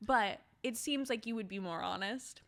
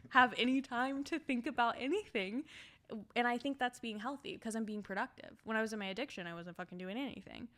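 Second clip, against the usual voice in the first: normal talking voice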